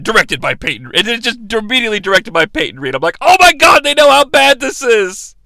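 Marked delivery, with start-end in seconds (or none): 3.2-5.3 high, loud mock disbelief; appalled outcry. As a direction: blurt it out in horrified realization. Acts out his appalled reaction.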